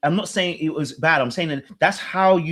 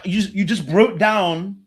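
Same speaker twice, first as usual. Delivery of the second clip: normal talking voice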